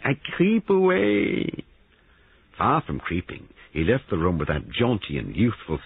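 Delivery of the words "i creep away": very high, slow sing-out exit; theatrical grovelling. Acted. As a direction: draw out the mock-humble farewell. Paravicini theatrically grovels away.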